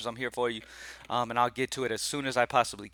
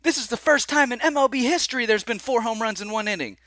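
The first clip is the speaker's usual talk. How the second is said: much higher stunned broadcaster call; excited announcer; 9 semitones higher than usual. Retold or imitated. Recounting the record inning to viewers, higher mock announcer. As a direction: mimic the announcer losing it over history. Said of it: "the first thing I hear is".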